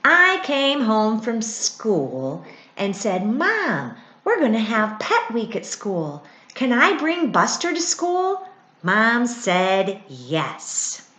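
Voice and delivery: put-on reading a picture book to children; gently paced storybook reading; story reading. A narrator's reading. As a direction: read it aloud like a bedtime book.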